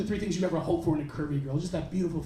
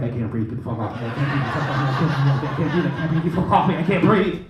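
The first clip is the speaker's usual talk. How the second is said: low, building louder panicked repeat; panicked yell; 8 semitones lower, 5 dB louder, faster than usual. Retold or imitated. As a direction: gasp it out faster and faster, louder each time. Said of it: "I gingerly yelled up to her".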